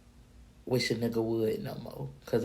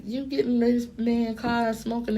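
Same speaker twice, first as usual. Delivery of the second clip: high, louder disbelieving call-out; incredulous scold; 10 semitones higher, 4 dB louder than usual. Retold or imitated. Scolding friend for smoking in the car, high voice. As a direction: chew him out, higher and louder.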